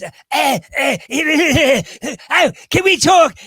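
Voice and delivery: high, loud raspy comedienne croak; Joan Rivers. Acted. Drag performer demonstrates how a celebrity impression starts, high brash voice. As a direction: open with croaked grunts, then a high brash cry. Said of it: "it starts with the voice".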